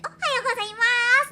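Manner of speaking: high, loud cutesy Japanese greeting; cutesy anime greeting. Shown as a demonstration. Showing the jarring Japanese original, high and loud cutesy. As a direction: chirp it like a cutesy anime girl. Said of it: "a character in Japanese that's like".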